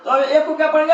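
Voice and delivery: ordinary delivery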